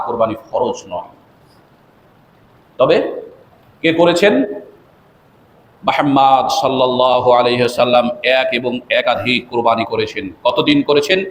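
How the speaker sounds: ordinary delivery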